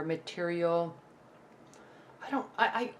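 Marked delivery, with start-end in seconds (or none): none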